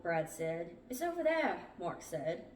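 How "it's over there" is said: high, urgent insistence; insistent. A narrator's reading. Mark directing the others to the raft, insistent. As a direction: call it out urgently.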